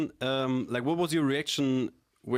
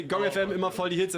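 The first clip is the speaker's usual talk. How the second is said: upbeat slogan demo; 6 semitones higher than usual. Shown as a demonstration. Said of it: "So you say".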